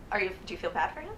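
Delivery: ordinary talk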